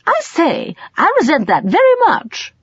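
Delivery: high, prim English protest; prim offended Englishman. A narrator's reading. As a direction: sniff it out like a prim gentleman. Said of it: "Colin interrupted primly".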